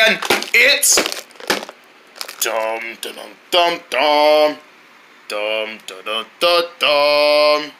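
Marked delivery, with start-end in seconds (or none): 2.4-7.7 low booming footstep beats; deep dramatic dum dum. Acted. Narrator building suspense, low menacing dums. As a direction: drum out the giant's steps. Dinosaur approach sound effect.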